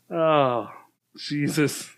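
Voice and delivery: ordinary delivery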